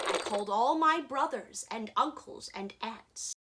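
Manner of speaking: ordinary delivery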